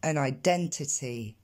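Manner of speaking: slow "An identity" with puffed t sounds; t aspirés. Shown as a demonstration. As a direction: model English "An identity", exploded t sounds. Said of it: "je vous invite à répéter derrière moi". (« An identity » lent, avec t soufflés)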